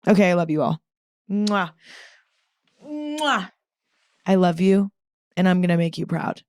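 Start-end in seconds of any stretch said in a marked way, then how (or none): 1.3-3.5 two soft kiss sounds, the second higher; blown kisses. Real, not acted. Saying goodbye to listeners with blown kisses. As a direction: blow kisses into the mic.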